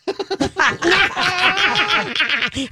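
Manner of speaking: high cackling witch laugh; witch cackle. Retold or imitated. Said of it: "Wicked Witch of the West".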